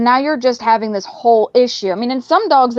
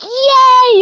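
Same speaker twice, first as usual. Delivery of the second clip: squealed high, loud yay; excited yay; 14 semitones higher, 5 dB louder than usual. Retold or imitated. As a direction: burst of praise for the dog. Praising the dog after sit.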